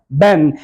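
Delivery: "बैन", said low with B in place of T; mispronounced ten. Shown as a demonstration. Teacher shows what ten sounds like, "बैन" with B. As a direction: say ten as "बैन", falling, no puff. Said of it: "ये कभी बैन लगता है".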